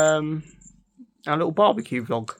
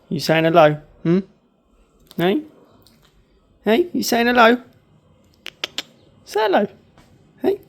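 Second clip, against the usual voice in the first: high coaxing pet voice; 9 semitones higher, 6 dB louder than usual. Real, not acted. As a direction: sweetly urge the dog to speak.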